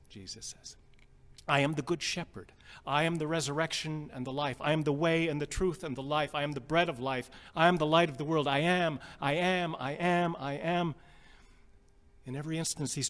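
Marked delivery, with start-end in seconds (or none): 1.5-10.9 louder, ringing proclamation; preaching Jesus's words. Acted. Preacher proclaiming Jesus's I am sayings, building and ringing. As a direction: build the I am sayings with growing force.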